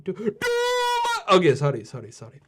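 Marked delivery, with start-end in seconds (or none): 0.0-1.4 high, very slow pleading falsetto; hammed-up drama queen whine; mock dramatic plea. Begging Mister Amin to stop, hammed-up high wail. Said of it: "too much drama".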